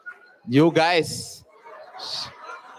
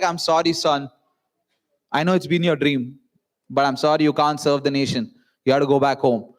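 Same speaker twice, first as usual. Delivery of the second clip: lower, grave doctor voice; a hint of a solemn army doctor; stern doctor's verdict; 7 semitones lower than usual. Army doctor telling the candidate he is rejected, gravely.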